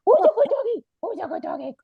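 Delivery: put-on high cooing baby talk to a dog; puppy voice. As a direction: gush over the puppy, pitch rising. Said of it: "talking in that puppy voice".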